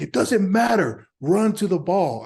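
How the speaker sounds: high, loud command; retold yell. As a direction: bark the order across the field. Teammate yelling instruction.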